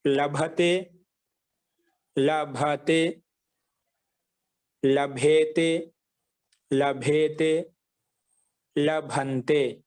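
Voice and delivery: measured, drawn-out లభతే, లభేతే, లభంతే; slow dictation. Shown as a demonstration. Teacher dictating verb forms for writing, slowly.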